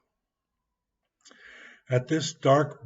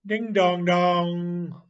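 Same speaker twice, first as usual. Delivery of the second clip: slow, higher ding-dong like a bell; bell sound; 4 semitones higher, slower than usual. Acted. Boy playing riverboat to himself, imitating a bell. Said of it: "Then he would say ... like a bell".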